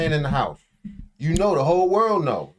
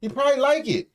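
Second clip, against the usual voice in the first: high teasing voice; 11 semitones higher, much faster than usual. Pretend.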